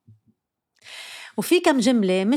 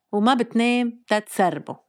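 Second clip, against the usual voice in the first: usual speaking voice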